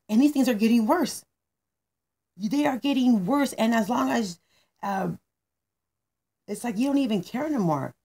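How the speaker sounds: angry tirade. Acted. Manny the manager explodes at Mia over her business, angry tirade. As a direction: let the complaints pile up, exasperated. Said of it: "when he was speaking so angry".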